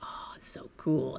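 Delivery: whispered, imitated marvelling; hushed awe. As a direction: whisper it like a watcher in quiet awe. Staff watching bobcat together, awed.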